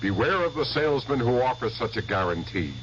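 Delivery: ordinary talk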